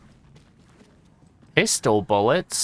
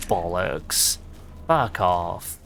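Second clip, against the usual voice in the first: ordinary talk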